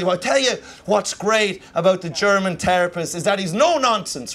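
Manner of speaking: ordinary talk, nothing marked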